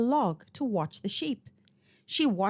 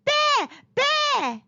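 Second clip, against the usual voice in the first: high, loud pretend alarm of Bear; fake loud cry; 5 dB louder than usual. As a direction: call out as loudly as possible for no reason. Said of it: "she cried as loudly as she could".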